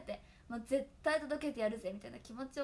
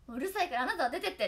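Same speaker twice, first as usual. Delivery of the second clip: mock scolding; 3 semitones higher, 8 dB louder, faster than usual. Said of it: "って言われるくらい".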